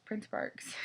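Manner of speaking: ordinary delivery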